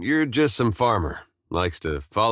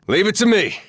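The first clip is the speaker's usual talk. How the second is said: loud, high, eager call; battle shout; 10 semitones higher, 5 dB louder than usual. Acted. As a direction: shout it while taking charge in combat. Battle bark, taking charge.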